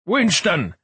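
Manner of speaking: high-pitched calling out; shout. A narrator's reading. Frock calls to the museum director, urgent shout. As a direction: call the name across a crowd. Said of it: "shouted Frock".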